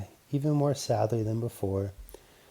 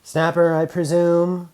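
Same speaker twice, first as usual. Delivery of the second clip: slow, louder, higher dignified greeting; brave robot voice; 6 semitones higher, 8 dB louder than usual. Acted. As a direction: greet the dog with calm courage. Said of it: "addressed ... bravely".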